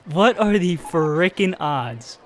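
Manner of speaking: slow, drawn-out repeat, stunned; slow, dragged-out stunned repeat; slow disbelief. Real, not acted. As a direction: stretch every word, shaking your head.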